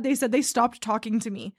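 ordinary talk, nothing marked

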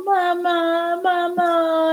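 mother mimics her crying son crawling to her; imitating baby crying mama. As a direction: wail it like a toddler reaching for mom. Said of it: "crawling towards me going".

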